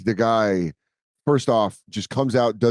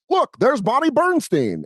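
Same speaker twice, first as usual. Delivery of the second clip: very high, slow announcer voice; hard sell ad; 14 semitones higher, slower than usual. Retold or imitated. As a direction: overblown TV pitchman. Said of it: "and he goes".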